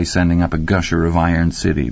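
usual speaking voice

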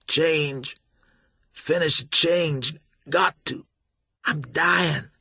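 high, strained, pain-racked begging; dying plea. A narrator's reading. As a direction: gasp out each word in agony. Dying Billy begs.

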